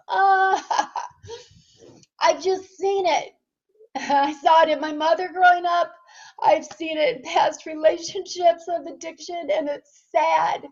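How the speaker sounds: fighting back tears, half-laughing; close to tears. Real, not acted. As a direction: voice rising high and wavering, laughing through the tears. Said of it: "Ooh, I'm gonna start crying".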